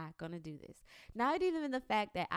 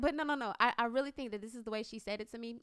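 ordinary talk, nothing marked

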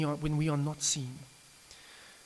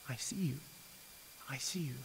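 tender reassurance; 2 semitones lower, 11 dB quieter than usual. Acted. God reassures the listener he is seen, tender. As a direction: hold them close with it.

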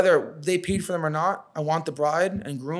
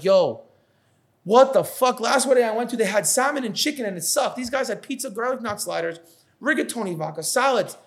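higher, louder hyped-up guest raving about the food; excited wedding guest; 7 semitones higher, 3 dB louder than usual. Retold or imitated. Guest amazed by the wedding food, raving loudly. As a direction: gush like a blown-away guest. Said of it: "I want all their guests to be like".

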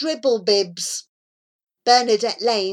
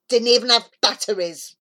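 ordinary delivery, nothing marked

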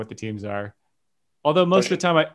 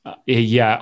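ordinary talk

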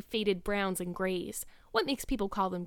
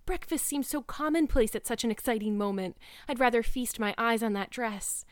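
ordinary delivery, nothing marked

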